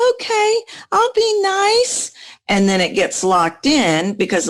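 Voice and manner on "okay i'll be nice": much higher, slow sweet voice; girly voice. Retold or imitated. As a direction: go up high and small. Voicing a woman giving in.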